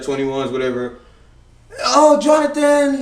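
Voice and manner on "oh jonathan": high, slow mocking hippie voice; mellow dreamy hippie. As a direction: mimic a preachy hippie. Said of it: "the hippie voices".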